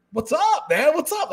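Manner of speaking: high-pitched, in-your-face hello; full-on friendly greeting. Shown as a demonstration. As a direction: come on strong but friendly, pitch way up. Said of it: "personality is a little bit, I don't wanna say it's aggressive".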